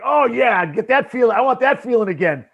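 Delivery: loud, high, greedy for the feeling; hammed-up craving for the good feeling; eager ego. Talking to himself before handing out bars, hammed-up eager craving. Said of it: "I'm like".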